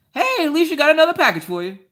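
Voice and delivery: high, loud, quick holler; fast, high-pitched put-on shout; imitated mailman call. Acts out mailman calling to her.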